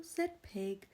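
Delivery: plain reading voice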